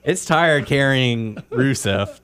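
slow, weary drag; slow tired voice. Pretend. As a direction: drag it out like exhausted. Voicing Lana's legs, slow.

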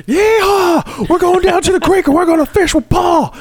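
high, loud hammed-up Southern twang; mock her country accent, loud and high; loud mock country twang, teasing the girl. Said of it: "a little twang to her voice".

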